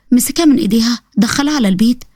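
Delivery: ordinary talk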